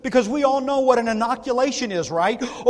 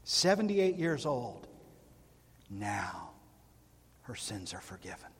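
softly, with quiet wonder; 13 semitones lower, 12 dB quieter than usual. Real, not acted. Repeated refrain, first quiet.

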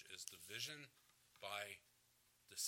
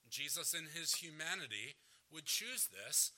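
ordinary delivery

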